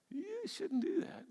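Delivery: a tiny high voice of conscience; high soft conscience voice; little inner voice. Retold or imitated. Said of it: "this little voice in the back of our head".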